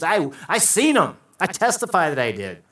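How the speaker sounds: high, loud sworn testimony; insistent witness on the stand. Acted. [name] answering the prosecutor's questions, as an insistent witness. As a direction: testify emphatically, slowing on the claim. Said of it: "he becomes a witness, you know".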